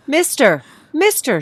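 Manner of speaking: loud high call across the station; shouting. A narrator's reading. Woman shouting to a young man on the steps. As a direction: shout to stop him. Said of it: "she shouted".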